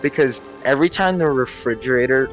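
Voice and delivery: normal talking voice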